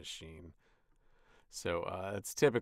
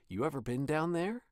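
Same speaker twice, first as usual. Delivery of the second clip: upbeat question with a sinister edge; happy killer; 7 semitones higher, 7 dB louder than usual. Shown as a demonstration. Voice actor reading the line as a happy killer. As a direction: ask it like a cheerful killer. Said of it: "Like a happy killer".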